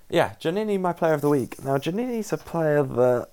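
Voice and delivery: high, loud burst of enthusiasm; loud, high-pitched hype for the player of the week; excited announcement. Real, not acted. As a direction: announce the pick like a big reveal.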